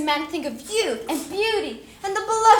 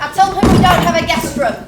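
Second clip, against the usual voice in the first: raised voice; 7 dB louder than usual. Acted. Heated argument with daughter.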